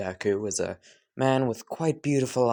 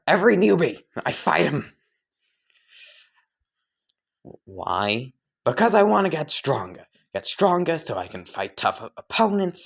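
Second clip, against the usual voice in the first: fired up; 5 semitones higher than usual. Acted. Akaza explains why he fights.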